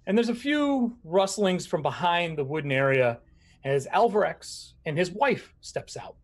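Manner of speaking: normal talking voice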